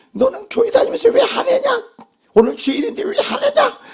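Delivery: high-pitched, indignant telling-off; mock scolding. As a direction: scold like an accusing churchgoer, voice shooting up. Acts out scolding an angry believer.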